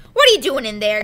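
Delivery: gruff growl through the door. A narrator's reading. First mate growls at the boy through the door, gruff. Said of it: "growled the voice of Mister Radford".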